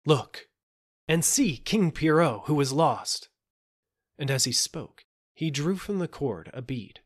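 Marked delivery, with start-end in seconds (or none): 0.1-3.3 higher, louder proclamation; crying out. A narrator's reading. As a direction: announce it to the whole court. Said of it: "cried he".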